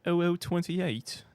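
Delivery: high, slow mock British accent; mock fancy British. Streamer mocking how the time is said, mock British. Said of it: "say everything in a fancy way".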